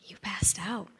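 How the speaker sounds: soft, unvoiced report; hushed whisper. Husband quietly telling his wife she fainted, whispered.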